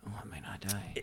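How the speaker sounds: barely voiced, very soft thought; whispered realization. Voices the injured man's thought.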